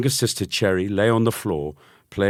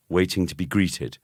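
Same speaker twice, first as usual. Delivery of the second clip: usual speaking voice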